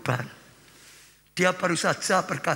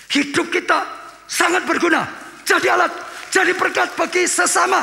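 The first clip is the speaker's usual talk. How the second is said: high, shouted sermon climax; preaching at full force; 13 semitones higher, 8 dB louder than usual. Acted. Pastor to congregation, shouting sermon climax.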